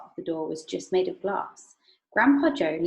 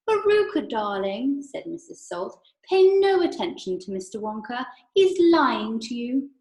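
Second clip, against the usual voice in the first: a haughty mother, pitched up and loud; Missus Salt's voice; 5 semitones higher, 6 dB louder than usual. Acted. A mother soothing her daughter against Mister Wonka, higher and louder.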